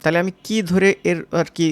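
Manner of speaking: ordinary talk, nothing marked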